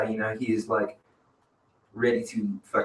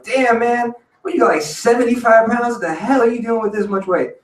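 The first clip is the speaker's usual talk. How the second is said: put-on high, amazed old-timer voice; surprised old man; 10 semitones higher, 4 dB louder than usual. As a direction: gasp at the heavy bag, pitched up high. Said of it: "he was like".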